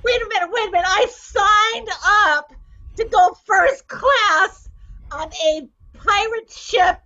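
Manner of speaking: pleading tourist. Acted.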